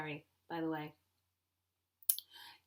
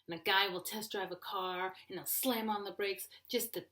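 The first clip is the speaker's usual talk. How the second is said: normal talking voice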